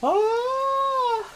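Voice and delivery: sudden high surprised yelp; a shocked cry on realising the mistake; startled oh. Real, not acted. GM notices audio problem.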